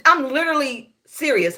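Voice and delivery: ordinary talk, nothing marked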